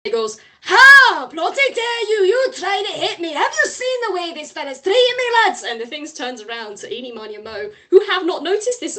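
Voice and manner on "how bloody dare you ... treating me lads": loud, high indignant shriek; outraged shout. Acted. Ghost head shrieking at Mister Peck and his lads. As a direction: howl in outrage at the attack.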